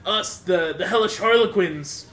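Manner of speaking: loud, high theatrical menace; booming threat. Acted. Hellish harlequin threatens the hostage, loud and grand. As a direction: roar it like a demonic gang boss. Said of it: "it's like".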